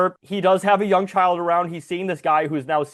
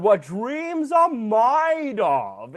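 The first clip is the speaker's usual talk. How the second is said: very slow, higher mock-British flourish; bad English accent; 6 semitones higher, much slower than usual. British bobby welcoming people to Hollywood, horrible English accent. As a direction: draw out a terrible English accent. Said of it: "horrible English accent".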